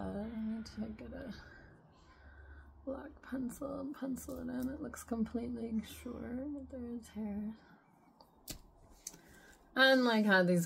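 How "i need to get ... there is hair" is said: hushed, unvoiced aside; soft whisper about her eyebrow. Real, not acted. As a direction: whisper it like a secret.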